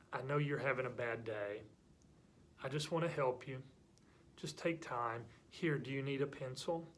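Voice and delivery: soft, gentle reassurance; nice, kind. Acted. Kind classmate helping someone having a bad day, gentle. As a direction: offer help softly and patiently. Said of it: "that person will keep being nice".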